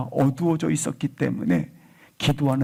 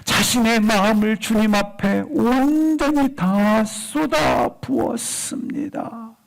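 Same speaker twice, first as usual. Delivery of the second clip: fervent preaching; 7 semitones higher than usual. Real, not acted. Preacher telling how Hannah poured out her soul, fervent preaching. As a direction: draw out the key words with preacher's fervour.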